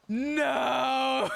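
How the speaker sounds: loud high burst of no; a flat retold no yelled out; retold shout. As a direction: shout the refusal as if reliving it. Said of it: "And I was like".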